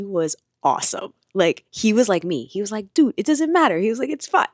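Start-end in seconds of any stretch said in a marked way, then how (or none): 2.9-3.8 high, loud mock New York surfer; brash New York accent, tossed off. Surf coach reassuring her in a mock New York accent. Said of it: "giving him a New York accent". 4.1-4.5 high, loud mock New York surfer; brash New York accent, tossed off. Surf coach reassuring her in a mock New York accent. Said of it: "giving him a New York accent".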